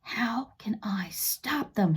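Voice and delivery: unvoiced, slow inner whisper; whispered thought. A narrator's reading. Boy thinking to himself in a hushed whisper. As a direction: hush it like a secret worry. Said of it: "he thought".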